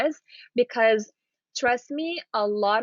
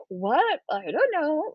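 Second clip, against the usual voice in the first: blurted confusion; 5 semitones higher, slower than usual. Shown as a demonstration. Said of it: "So you're not gonna say".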